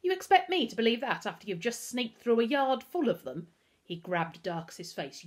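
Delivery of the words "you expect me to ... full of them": louder, higher, scornful disbelief; incredulous Pickering. Acted. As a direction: challenge the boy's story angrily.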